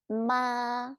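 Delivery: "Ma" held on a high level note; first tone. Shown as a demonstration. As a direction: keep "Ma" level and long. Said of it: "first tone".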